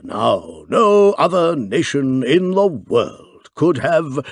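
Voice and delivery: slow, lightly voiced pompous chairman; a hint of an old clubman holding forth; slow club chairman, lightly done; chairman's voice. A narrator's reading. Chairman speaks at banquet.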